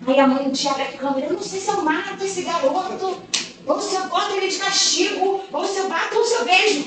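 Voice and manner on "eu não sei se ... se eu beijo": high, hushed, mock-desperate mother at wit's end; a touch of a torn, half-laughing mom; exasperated mother.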